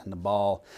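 Nabal with stress on the second syllable, "nuh-BALL"; preferred pronunciation of Nabal. Shown as a demonstration. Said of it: "that's how I, I like to pronounce it".

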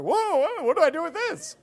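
high, loud voice of comic alarm; mock bewilderment. Newcomer handed an instrument in heaven, mock bewilderment. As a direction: play someone baffled and flustered.

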